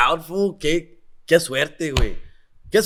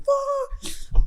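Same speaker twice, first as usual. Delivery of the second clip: mock dread. Called next, dread.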